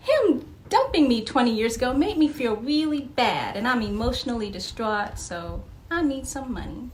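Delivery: high, loud mock epiphany; mock realization. Imagined therapy patient, mocking her sudden realization. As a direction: act out a sudden fake discovery. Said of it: "And suddenly you're like".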